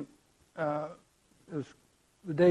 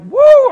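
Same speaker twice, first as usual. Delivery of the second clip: loud, high-pitched cheer; excited whoop; 16 dB louder than usual. Retold or imitated. Fan whose team won the game, excited scream. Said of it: "I was excited".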